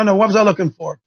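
ordinary talk

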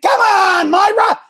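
high, loud exasperated call; mock shout. Coach recalling how he yelled at Myra, loud high shout. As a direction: bark her name like a coach on the sideline. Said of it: "Isn't that what I said?".

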